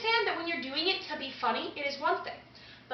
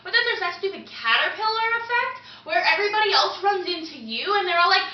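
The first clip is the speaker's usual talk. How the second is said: ordinary talk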